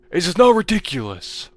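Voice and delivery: high, loud exasperated yell; exasperated shout. Real, not acted. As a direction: yell it at the game in disbelief. Exasperated gamer shouting at game.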